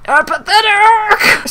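slow, very high, loud scream; drawn-out full-volume shriek; screamed mock outrage. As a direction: scream the word and stretch it. Second, bigger outraged cry.

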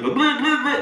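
high, loud tongue-flapping blah; floppy-tongue babble. Shown as a demonstration. Hypnotist showing a tongue falling out, babbled. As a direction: let the tongue flop through the noise. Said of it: "your tongue just gets in the way".

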